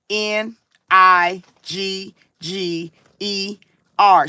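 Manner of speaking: letters spaced out one by one; slow letter-by-letter spelling; spelled out slowly. Retold or imitated.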